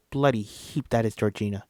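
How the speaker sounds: plain reading voice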